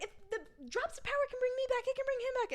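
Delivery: high, quick, urgent pleading voice; desperate plea. Retold or imitated. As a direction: talk fast, clinging to hope. Feyre pleading to revive mate.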